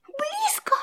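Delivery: high, frightened whispered squeak; worried whisper. A narrator's reading. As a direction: whisper it like a scared mouse. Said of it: "заволновался мышонок".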